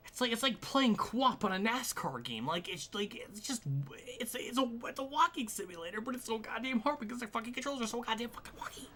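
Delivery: high, fast exasperated complaint; exasperated rant. Real, not acted. Gamer ranting up high about the controls.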